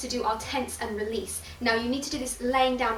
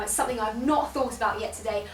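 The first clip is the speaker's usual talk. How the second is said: ordinary talk, nothing marked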